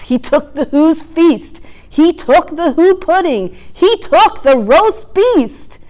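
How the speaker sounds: high, sly gloating lines; greedy Grinch. A narrator's reading. Grinch gloating over the Whos' feast.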